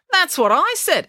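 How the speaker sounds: high-pitched, vindicated outburst; exclaiming. A narrator's reading. Finn backing Mum's criticism of the dinosaur, exclaiming. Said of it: "exclaimed Finn".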